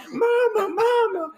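very high mock little-sister cry of Mama; imitating the sister crying out to their fallen mom; sister calling mom. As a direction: call out like a small kid worried for mom.

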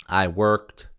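normal talking voice

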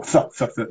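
short, isolated sound; TH in quick little bursts. Shown as a demonstration. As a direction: keep the TH short, repeated separately. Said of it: "just be a sort of short sound".

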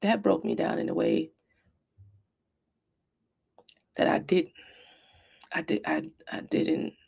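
halting and soft, choked by regret; real heartbreak. Real, not acted. Mother recalls her son breaking her heart, soft and faltering. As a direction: falter quietly, with long pauses.

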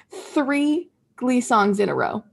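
ordinary talk

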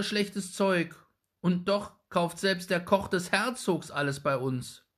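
put-on high, loud, cross boy's voice; a small boy telling off an old woman; indignant boy. A narrator's reading. Young Jakob scolding the rude old woman, loud and high. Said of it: "rief er unmutig".

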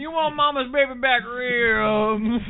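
hammed-up infomercial hard sell. Mock southern TV ad.

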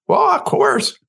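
the wife quoted happily agreeing to help; eager wife. Retold or imitated. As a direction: chirp the yes like a willing wife.

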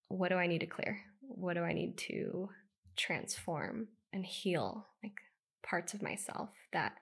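soft, hushed inner questioning; almost whispered, turning inward; softly confessing her deepest doubts; quiet whisper. Real, not acted.

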